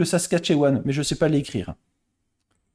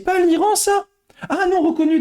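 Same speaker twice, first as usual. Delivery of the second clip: surprised; 12 semitones higher, 3 dB louder than usual. Real, not acted. Guessing a quiz answer.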